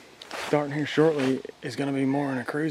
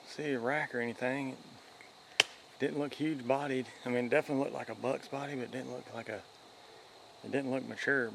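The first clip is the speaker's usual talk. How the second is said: wildlife watching whisper; 10 dB quieter than usual. Real, not acted. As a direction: keep it down so the deer won't hear. Just jumped a bedded deer nearby.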